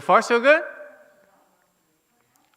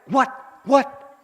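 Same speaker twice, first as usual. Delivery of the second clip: mock alarm; 11 semitones higher, 11 dB louder than usual. Acting startled mind.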